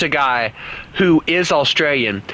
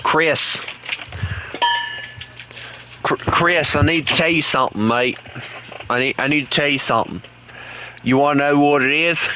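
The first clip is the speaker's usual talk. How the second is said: put-on Aussie accent, pestering a friend; Australian accent. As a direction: nag playfully in mock Australian. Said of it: "keep on talking in this, uh, this accent".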